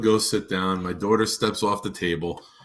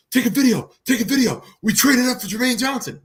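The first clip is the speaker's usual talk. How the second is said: much higher and louder, jubilant yelling; retold excited shout; 14 semitones higher, 6 dB louder than usual. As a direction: relive the celebration, shouting. Retold celebrating draft trade-up.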